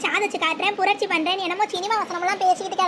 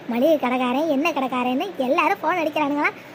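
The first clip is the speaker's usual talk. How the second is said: ordinary talk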